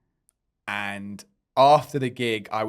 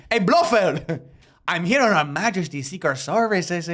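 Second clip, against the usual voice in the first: James Bond; 5 semitones higher, 3 dB louder than usual. Acted.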